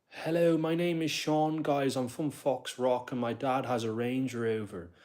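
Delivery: high, drawn-out posh Dublin accent; posh Foxrock accent. Shown as a demonstration. Streamer showing how rich Dubliners talk, posh Foxrock accent. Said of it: "they talk like this. This is their accent".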